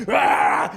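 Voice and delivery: low unvoiced mock roar; hammed-up low growl of rage; murderous growl. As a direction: roar like an enraged beast. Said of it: "he went from, like".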